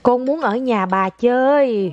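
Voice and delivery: put-on very slow, word by word, for a child to repeat; slow model phrase. Shown as a demonstration. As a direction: stretch each word out as a lesson. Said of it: "con nói". (mẹ nói thật chậm cho con lặp lại)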